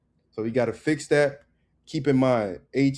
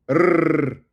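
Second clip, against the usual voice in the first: loud, higher sustained trill; rolled R trill; 4 semitones higher, 8 dB louder than usual. Shown as a demonstration. Teacher showing the rolled R, loud long trill. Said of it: "that rolled R".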